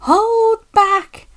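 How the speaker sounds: high-pitched, gleeful squeal; loud, high, mischievous singsong; giggly. A narrator's reading. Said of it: "giggled the trucks to each other".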